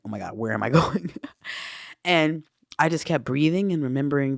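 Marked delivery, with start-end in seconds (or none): 0.0-1.1 quick, low line breaking into a laugh; amused disbelief. Retold or imitated. Speaker recalling travel through space and time, laughing disbelief. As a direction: laugh through mock bewilderment.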